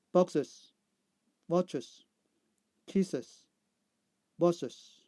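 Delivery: isolated words, each ending in an added "iz" syllable; careful model reading, final syllable sounded. Shown as a demonstration. Teacher repeating answers for learners, "iz" syllable endings. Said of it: "I see sound".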